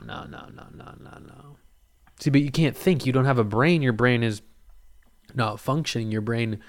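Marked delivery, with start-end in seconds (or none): 0.0-1.6 breathy rapid no no no fading away; whispered denial. Real, not acted. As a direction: whisper the no's to nothing.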